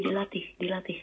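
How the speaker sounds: unvoiced whispered urging; whispering. Real, not acted. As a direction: whisper it under your breath.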